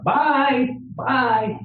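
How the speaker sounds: high, sing-song mocking bye bye; snippy goodbye. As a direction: wave them off with fake sweetness. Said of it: "she really snapped".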